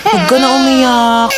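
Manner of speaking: put-on calm the crying baby down; soothing a crying baby.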